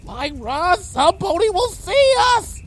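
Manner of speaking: very high, anxious whimper; high-pitched panicky plea. A narrator's reading. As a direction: whimper it in dread of discovery. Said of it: "Bon Bon whimpered".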